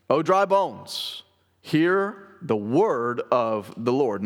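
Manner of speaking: slow preaching. Retold or imitated. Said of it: "a powerful preaching".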